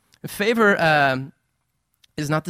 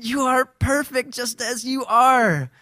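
put-on high, drawn-out gushing to a baby; slow, high-pitched baby-adoring voice; doting parent; 8 semitones higher, slower than usual. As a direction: plead adoringly with a little one. Father dotes on his baby.

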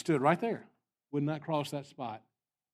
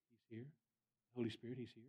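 much softer, reverent repeat of the preacher's words; quietly imitating a preacher's announcement; hushed announcement; 11 dB quieter than usual. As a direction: let it drop right down, quoting him. Repeating the announcement more softly.

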